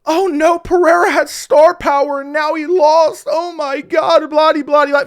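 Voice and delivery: high, loud mock wail of upset fans; mocking crying fans. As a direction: ham up a panicking fan, blah blah and all. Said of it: "I've seen fans crying like".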